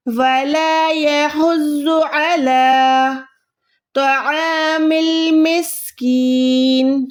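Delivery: slow melodic tajweed chant; Quran recitation. Acted. Tutor reciting the verse for her student: slow tajweed chant. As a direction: chant the verse slowly and evenly.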